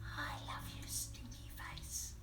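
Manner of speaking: soft tender whisper. Acted. Mum whispering love to her child. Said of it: "whisper in your ear".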